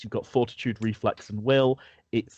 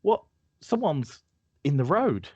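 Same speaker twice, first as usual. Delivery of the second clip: higher, startled; spotting someone ahead; alarmed Marion; 6 semitones higher than usual. Acted. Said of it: "she says".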